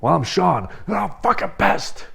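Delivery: plain introduction jumping to a high, strained mock yell; playful imitation of a pissed-off outburst; teasing mock fury. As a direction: parody an angry introduction as a running joke. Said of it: "every time he calls me".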